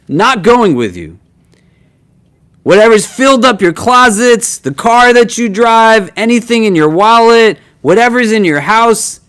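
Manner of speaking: high, loud preaching build. Acted. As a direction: preach it with rising fervor. Impassioned sermon on deeds.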